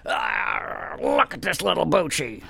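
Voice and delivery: imitating manosphere guy. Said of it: "They put me in a headlock and say".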